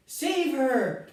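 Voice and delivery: high panicked cry; screaming. A narrator's reading. Missus Salt screaming to save her daughter, high panic. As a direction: beg someone to rescue her child. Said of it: "screamed Missus Salt".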